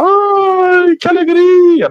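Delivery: high, sugary fake delight; mock cheerful gushing. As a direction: gush like throwing confetti. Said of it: "jogar confete e falar".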